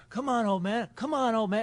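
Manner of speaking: mock teasing dare, high; taunting the old man. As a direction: egg him on to chase you. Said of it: "I'd be like".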